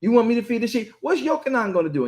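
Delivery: change the subject, cheeky; nosy deflection. Retold or imitated. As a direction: Peter deflecting to ask about Yochanan, sassy. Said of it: "Yeshua had to check him".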